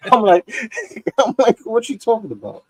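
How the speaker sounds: high, laughing retelling. Real, not acted. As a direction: crack up while telling it. Laughs while telling own funny story.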